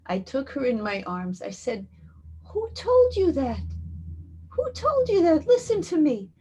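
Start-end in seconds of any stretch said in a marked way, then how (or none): 2.4-6.3 high, loud, insistent challenge; impassioned protest. Retold or imitated. Woman holding the little girl refuses her despair, high and insistent. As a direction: cry it out as urgent reassurance. Passionately contradicting grieving child.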